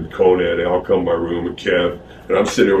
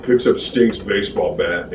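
ordinary delivery

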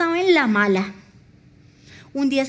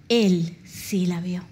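slow, lower, weighty stress on Él; slow emphasis; 6 semitones lower, slower than usual. Acted. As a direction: drop the pitch and slow right down on Él. Slow emphatic sermon declaration.

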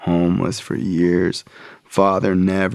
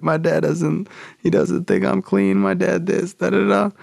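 higher pitched, whining and upset; whiny complaint; 10 semitones higher than usual. Retold or imitated. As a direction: complain like a wounded kid. Whining to sponsor while crying.

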